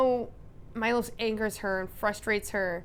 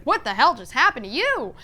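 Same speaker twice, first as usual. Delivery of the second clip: loud, high, shocked question; alarmed disbelief; 5 semitones higher, 9 dB louder than usual. Acted. Sanctuary crowd seeing her clutch her hand, shocked. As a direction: demand it loudly, pitch swooping up. Everyone alarmed at Odette's arrival.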